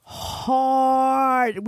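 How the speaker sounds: "hard" stretched out long; a long, emphatic "hard"; drawn-out emphasis. Real, not acted. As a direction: lean on "hard" and drag it slowly. Stressing how hard they partied.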